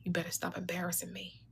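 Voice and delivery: a harsh whispered warning, no voice at all. Retold or imitated. Mom whispering a warning to her child in the store. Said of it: "Your mom whispered in your ear".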